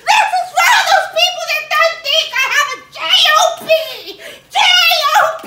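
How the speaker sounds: high, fast laughing shout. Acted. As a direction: blurt it at a shriek. Continues shrieking after the cash.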